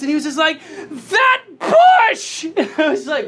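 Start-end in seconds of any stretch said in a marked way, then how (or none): 0.9-2.4 high, loud mock outburst of fury; furious little kid. Four-year-old son furious about the bush, mock furious yell. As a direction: imitate an enraged four-year-old yelling. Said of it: "he was so furious".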